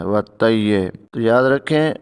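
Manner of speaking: ordinary talk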